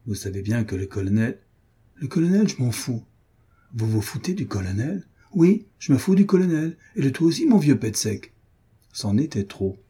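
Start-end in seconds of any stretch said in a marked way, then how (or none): 2.0-8.3 defiant retort. A narrator's reading. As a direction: throw it back in his face. Soldier defies officer.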